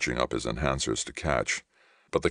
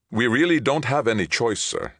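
high, louder, dropping on last word; tense appeal; 9 semitones higher, 4 dB louder than usual. Acted. Captain tells Ray surrender is unavoidable, tense. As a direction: urge him, pitched up.

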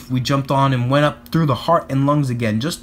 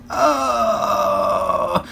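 sneeze wind-up; 5 semitones higher than usual. Acted. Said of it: "That's when you say".

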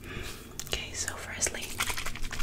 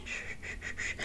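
soft shushing; 9 dB quieter than usual. Acted.

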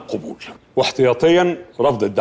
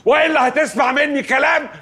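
high, insistent rebuke; angry scolding; 8 semitones higher than usual. Acted. Lawyer warns client off pestering the office, angry scolding. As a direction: lay down the law, climbing to a warning.

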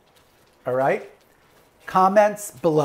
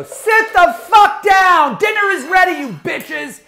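furious high scream; 10 dB louder, slower than usual. Acted. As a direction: scream at the guests to sit. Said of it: "scream at your guests and go".

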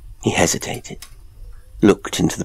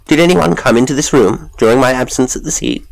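raised, higher voice questioning the butler; high, sharp voice; 7 semitones higher, 7 dB louder than usual. A narrator's reading. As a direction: demand an answer, high and loud. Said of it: "speaking in a high, sharp voice".

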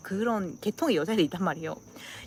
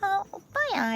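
very high cutesy call; flirty aegyo; 12 semitones higher, much slower than usual. Acted. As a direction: coo flirtatiously in a high little voice. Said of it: "여자애들이 막 남자애들한테 플러팅하고".

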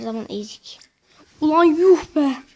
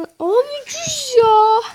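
high, drawn-out, slow shocked cry; stunned exclamation; 12 semitones higher, 6 dB louder, much slower than usual. Real, not acted. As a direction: stretch it out in disbelief.

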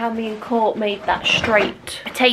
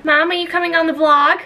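calling out; 6 semitones higher, 3 dB louder than usual. Real, not acted. Calling to mum in another room.

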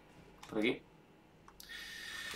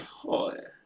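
1 whispered curse; 10 dB quieter than usual. Real, not acted. Curse after losing.

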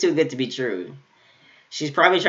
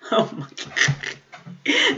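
laughing; 6 semitones higher, much slower than usual. Real, not acted. Overwhelmed watching anime.

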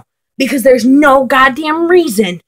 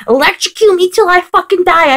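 ordinary delivery

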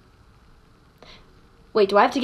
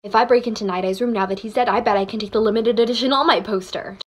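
fast, run-on mumble; mumbling; 3 semitones lower, faster than usual. Acted. Nerd plotting to take a poster, fast mumbling. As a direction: mutter the plan to yourself quickly. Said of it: "Quit the mumbling".

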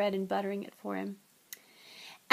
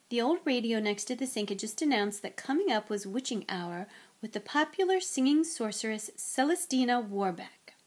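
plain reading voice

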